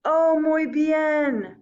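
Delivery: cheerful friend. Pretend. Sammy the Spanish guy answering the teacher brightly. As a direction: play Sammy, delighted to be asked. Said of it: "Sammy's probably gonna be like".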